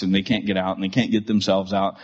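ordinary delivery

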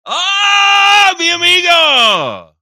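loud, high greeting shout to a friend; excited shout. Real, not acted. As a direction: yell a delighted hello to the caller. Greeting caller loudly.

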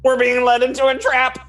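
high nervous character voice. Acted. Companions warning of a trap, high and nervous. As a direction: fret that it's a trap.

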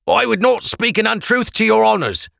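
high, thin voice of a frightened prisoner; pleading prisoner voice. Acted. Prisoner pleading with the Blackcoats, high and anxious.

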